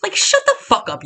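furious fan venting at Twitter critics; heated rant. Real, not acted. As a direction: let the frustration boil over at the know-it-alls. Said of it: "I'm getting a little heated".

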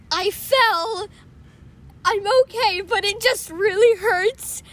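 high, loud mock wail; hammed-up tearful cry to the parents; imitated loud crying. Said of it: "loud crying".

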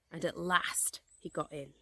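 ordinary delivery

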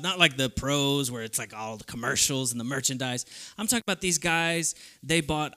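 ordinary delivery

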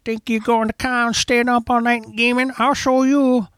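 put-on high, creaky old-timer taunting; old prospector. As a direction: challenge them like a cranky old geezer. Mocking old man staying up.

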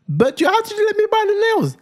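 very high, exaggerated African accent; African accent coming out. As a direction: mimic your wife's accent slipping out when outraged. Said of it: "the Af accent wasn't trying to come out of Blessing".

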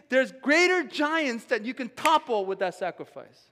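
high, loud, driving sermon climax; building preaching fervor; fiery preaching. Real, not acted. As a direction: preach it like a rally cry. Preaching builds to full force.

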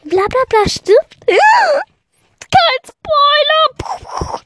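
viel höher und lauter, entsetzt; gespielter Schock. Retold or imitated. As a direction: kreisch empört über den Spoiler. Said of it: "Und der so". (übertrieben schockiert, Schlusswort lang gezogen)

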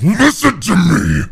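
loud, slow, forceful bellow; angry shout. Acted. Desmond grabs his brother and roars at him. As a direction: seize him and roar it in his face. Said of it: "grabs him by the chest, and says".

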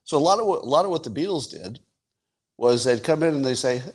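ordinary talk

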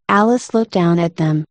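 usual speaking voice

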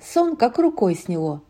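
ordinary delivery, nothing marked

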